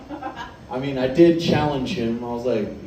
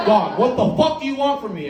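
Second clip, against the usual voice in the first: higher-pitched acted exasperation; exasperated protest; 8 semitones higher than usual. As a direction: protest like you've been pushed too far. Acted protest at Barry's pressure.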